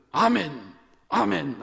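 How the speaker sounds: loud, high Amen called out; shouted amen. Shown as a demonstration. Congregation shouting amen at the pastor, loud and high. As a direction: shout it back at the preacher. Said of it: "하고 외치세요".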